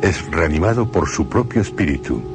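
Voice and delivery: usual speaking voice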